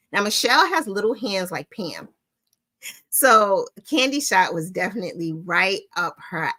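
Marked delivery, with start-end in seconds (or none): none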